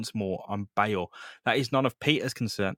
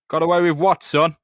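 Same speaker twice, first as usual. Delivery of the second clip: loud gruff cop demanding an answer; gruff cop challenge; 5 semitones higher, 9 dB louder than usual. Acted. Cop grilling Peter, loud and gruff. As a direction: bark it like a suspicious cop. Mocking cop voice in comic recap.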